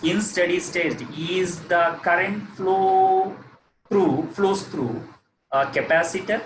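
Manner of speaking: slow, deliberate question to the class; slow emphatic question. Shown as a demonstration. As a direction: draw the question out slowly. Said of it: "that is my question".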